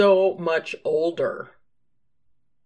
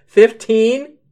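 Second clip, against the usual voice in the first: usual speaking voice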